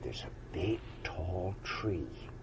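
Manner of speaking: secret whisper; old frog whispering the secret to the unicorn. Acted. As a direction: let them in on a secret.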